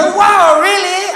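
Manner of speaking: high, loud delighted gasp; excited woman. Retold or imitated. Woman thrilled by the sweet talk, gasping wow. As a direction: squeal it out, thrilled. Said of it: "You say".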